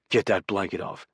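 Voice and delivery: low, curt command; gruff order. A narrator's reading. Bossy roommate orders the blanket off the bed, mildly commanding. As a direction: give a mild, low order. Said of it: "he orders".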